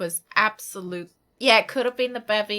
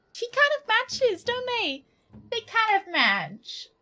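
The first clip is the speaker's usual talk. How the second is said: high sing-song voice for the dog; 11 semitones higher, slower than usual. Real, not acted. Talking to her dog, high cooing dog voice. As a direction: coo it up high like talking to a pet. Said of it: "I've got a different voice for Suzu".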